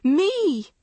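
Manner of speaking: loud, high frantic cry; frantic scream. A narrator's reading. Girl to her mother, frantic scream. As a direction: scream it out in panic. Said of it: "Maggie screamed frantically".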